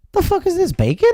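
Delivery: high, shocked "bacon?"; sudden disbelief at the window; incredulous outburst. Retold or imitated. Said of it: "you look at me and you go".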